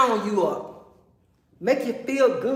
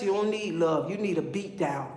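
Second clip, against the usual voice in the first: ordinary delivery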